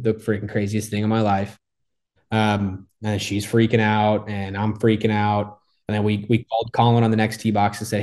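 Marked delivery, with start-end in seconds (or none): none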